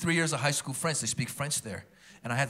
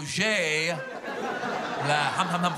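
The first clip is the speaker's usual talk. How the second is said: mock retching; 2 semitones higher, much slower than usual. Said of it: "I go".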